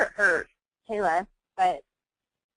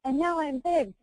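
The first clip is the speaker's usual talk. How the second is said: put-on proud little boy; 7 semitones higher than usual. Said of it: "like Hulk".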